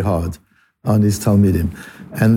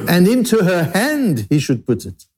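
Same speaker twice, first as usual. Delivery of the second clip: "into her hand" raised high, the rest tailing down; emphasis on hand; 4 semitones higher than usual. Shown as a demonstration. Teacher translating the emphatic word order, stress on "hand". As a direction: lift and lean on "hand", drop "he should put it". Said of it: "That's how you emphasize".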